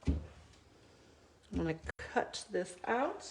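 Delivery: normal talking voice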